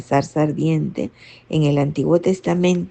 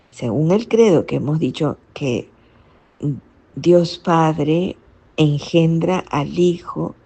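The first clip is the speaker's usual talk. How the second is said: ordinary talk, nothing marked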